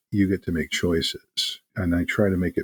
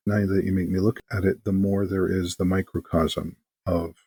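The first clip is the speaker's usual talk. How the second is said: ordinary talk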